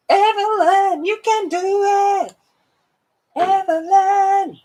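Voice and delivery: very high, drawn-out sing-song encouragement; squeaky-high chanted pep talk; sing-song cheer. Pretend. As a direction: cheer them on in a high held falsetto. Cheering on viewer Evelyn.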